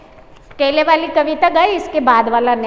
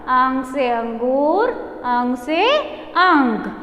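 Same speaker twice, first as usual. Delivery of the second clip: slow repeat-after-me drill; much slower than usual. Shown as a demonstration. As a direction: chant it slowly for children to follow. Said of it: "six time आप इसको बोलो". (बच्चों से दोहरवाने की धीमी, खिंची हुई लय)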